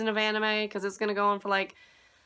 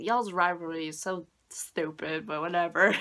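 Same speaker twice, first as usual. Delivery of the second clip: dismissive muttered; 3 semitones lower, 3 dB quieter than usual. Retold or imitated. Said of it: "I'm like".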